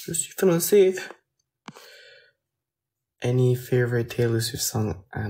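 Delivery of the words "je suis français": raised repeat. Shown as a demonstration. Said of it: "Oui, je suis français".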